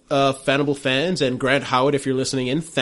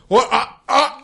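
choking gasp; 11 semitones higher, 6 dB louder, slower than usual. Acted. GM voices dying man choking.

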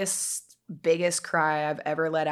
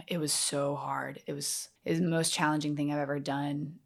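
normal talking voice

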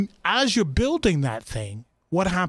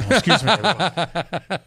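laughing. Real, not acted. Joking exit, laughing.

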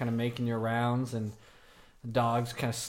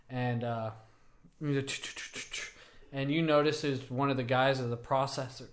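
usual speaking voice

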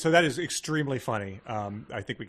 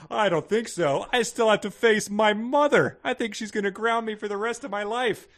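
high, loud teen complaint, acted; Samira; 9 semitones higher, 7 dB louder than usual. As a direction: ham up dread of being grounded. Voices Samira's reply.

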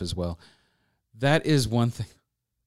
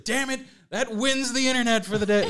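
higher, grudging mock groan; mock annoyance; 10 semitones higher than usual. Rivals at the photo shoot grudging, mock annoyed. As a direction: lose gracefully, half-joking. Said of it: "everyone was like".

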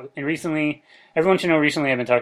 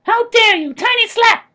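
very high, loud tiny creature voice; tiny fairy taking offense; indignant tiny creature; 6 dB louder than usual. Acted. Creature slaps Dan indignantly.

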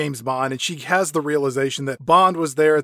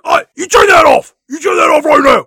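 high, full-force outraged shout; screaming Bond; 11 semitones higher, 15 dB louder, faster than usual. Acted. Bond screaming at Blofeld over the video, screamed. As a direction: scream the order across the room. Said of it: "Bond starts screaming".

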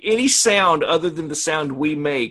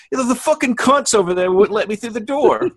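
put-on Kiwi accent; 8 semitones higher than usual. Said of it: "his thick accent".

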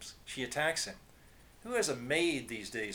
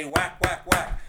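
hammered-out whacks, much louder; loud whack sound effects; 5 semitones higher, 11 dB louder, slower than usual. A narrator's reading. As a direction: slam out each whack. Mop hits build louder.

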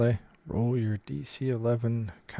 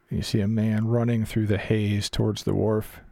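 usual speaking voice

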